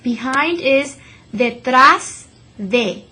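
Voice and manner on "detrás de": "detrás" slow, last syllable trás punched; stress on trás. Shown as a demonstration. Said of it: "stress that last syllable".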